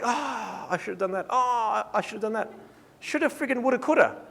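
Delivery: high, groaning hindsight; mock regret. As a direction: kick yourself over a missed chance, hammed up. Acts out regret over missed shots.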